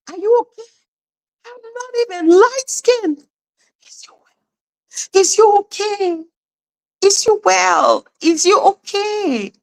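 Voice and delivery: lightly hammed-up busybody; mock concerned.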